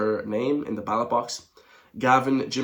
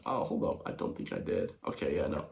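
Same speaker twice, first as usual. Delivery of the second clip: soft, unvoiced whisper under his breath; whispered to himself; 8 semitones lower, 9 dB quieter than usual. Real, not acted. Vlogger checks if he skipped a ballot, whispering to himself. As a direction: hush it while checking back.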